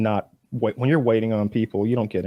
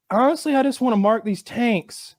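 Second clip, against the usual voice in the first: quoting himself; 10 semitones higher than usual. Retold or imitated. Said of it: "I was like".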